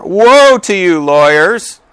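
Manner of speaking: loud, high, drawn-out denunciation; declaimed woe. Acted. Jesus rebuking the lawyers, loudly declaimed. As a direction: proclaim it slowly, high and loud. Reads verse with force, then repeats.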